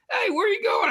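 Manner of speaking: high-pitched call after a friend; retold shout across the slope. Friend calling after him as he leaves the slope, calling out.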